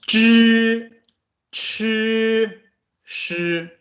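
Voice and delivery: "Zhi, chi, shi" said high and loud, the tongue curled back; Mandarin retroflex demo. Shown as a demonstration. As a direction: demonstrate the retroflex sounds for students. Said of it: "How does this pronounce?".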